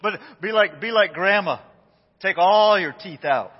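laughing through the words. Real, not acted. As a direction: giggle while joking.

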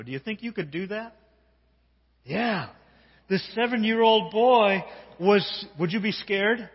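usual speaking voice

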